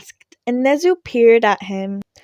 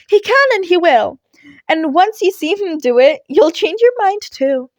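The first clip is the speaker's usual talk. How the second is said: higher, louder voice for the scoffing heroine; Midnight's voice; 7 semitones higher, 5 dB louder than usual. A narrator's reading. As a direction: raise the pitch, confident and teasing.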